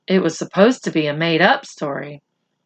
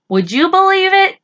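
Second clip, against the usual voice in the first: much higher, louder rhetorical question; incredulous question; 12 semitones higher, 4 dB louder than usual. Real, not acted. Reader asking the children if they'd believe it, high and incredulous.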